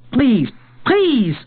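very high, loud cries; desperate pleading. A narrator's reading. Boy begging the gunslinger to turn back, desperate cry. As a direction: beg desperately, voice leaping up. Boy begs, fist raised.

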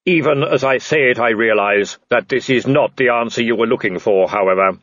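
level, booming supernatural figure; Death. Acted. Death addressing the man in the tree, solemn intoning. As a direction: intone it evenly, without hurry. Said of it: "Death hesitated and then went on".

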